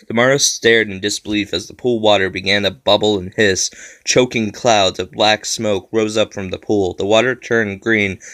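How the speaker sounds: ordinary delivery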